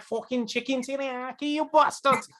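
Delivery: high-pitched British caricature; mock British accent. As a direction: swear in an exaggerated British voice. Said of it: "Your British accent".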